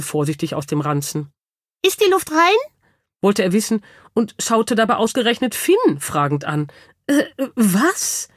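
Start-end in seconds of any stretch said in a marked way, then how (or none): 1.8-2.7 very high, squeaky cautious question; high-voiced hamster. A narrator's reading. Hamster peeking from the satchel, high-voiced question. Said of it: "mit einer hellen Stimme". 7.1-8.3 higher, startled stammered question; gasping. A narrator's reading. Boy suddenly addressed by a hamster, gasping. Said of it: "japste Finn".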